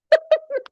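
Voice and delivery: high squealing laugh of put-on delight; giddy laugh. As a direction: burst into a delighted giggle. After mock excitement.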